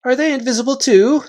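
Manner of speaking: Reepicheep, mocking. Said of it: "said Reepicheep".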